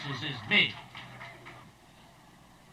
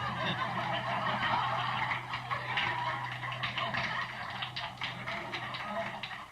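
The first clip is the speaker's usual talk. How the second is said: soft breathy laugh; 3 semitones higher, 7 dB quieter than usual. Real, not acted. Audience laughter after punchline.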